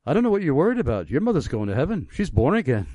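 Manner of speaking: imitating a Welsh friend's lilt, quick and high-pitched; thick Welsh accent. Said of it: "in his thick Welsh accent".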